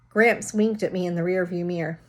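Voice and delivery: usual speaking voice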